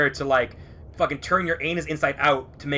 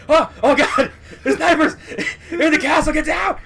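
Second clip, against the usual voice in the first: much higher, louder panicked shout through laughter; laughing panic; 12 semitones higher, 7 dB louder than usual. Retold or imitated. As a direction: yell a warning while cracking up.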